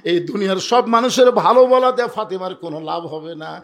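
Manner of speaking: usual speaking voice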